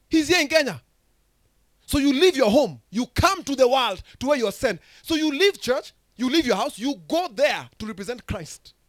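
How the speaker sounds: loud, high, fervent preaching; full-throated pulpit preaching; preaching at full force. Acted. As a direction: preach it out to the whole hall. Preaching burst.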